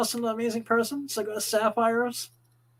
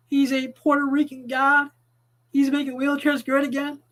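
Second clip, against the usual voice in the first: ordinary talk, nothing marked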